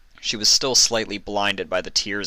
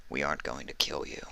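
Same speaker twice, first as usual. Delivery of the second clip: low, soft and reassuring; quiet low calming voice; 4 semitones lower, 10 dB quieter than usual. Acted. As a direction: hush her gently. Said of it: "he said calmly".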